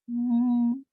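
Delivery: very high squeaky hum; a tiny high doll's mm. Acted. Doll pretends to answer.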